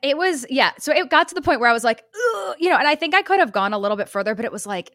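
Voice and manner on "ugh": high strained groan, reenacted; contraction groan. Acts out a painful contraction.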